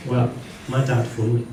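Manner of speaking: ordinary delivery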